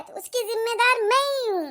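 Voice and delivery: usual speaking voice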